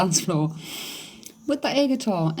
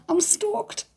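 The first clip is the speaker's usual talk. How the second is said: thrilled squeal; 13 semitones higher than usual. Real, not acted. Camera arriving tomorrow.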